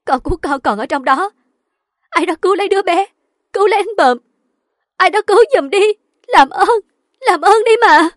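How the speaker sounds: high, loud tearful begging; screaming sobs. A narrator's reading. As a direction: plead for rescue, wailing. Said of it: "Mận khóc thét". (khóc thét cầu cứu)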